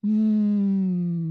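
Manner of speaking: nasal hum; humming. Shown as a demonstration. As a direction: hum into the nose. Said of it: "It's a little tickly there".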